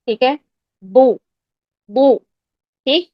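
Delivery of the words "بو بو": "بو" as a thick back Arabic Dad with oo; heavy Dad with damma. Shown as a demonstration. Teacher drilling damma with students, "بو" as a heavy Doo. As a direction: fill the mouth for "بو", a heavy Doo. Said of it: "اب آپ گما لگا کر practice کریں".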